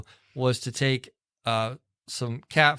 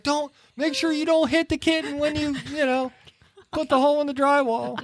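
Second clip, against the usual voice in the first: much higher voice of the worried wife; imitating frantic wife; 13 semitones higher than usual. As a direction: squeak out her anxious warning. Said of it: "and she's like".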